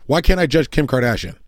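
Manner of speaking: normal talking voice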